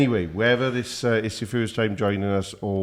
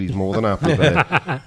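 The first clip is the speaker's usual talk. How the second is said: laughing; 5 semitones higher, 6 dB louder than usual. Real, not acted. Teasing co-host about mics.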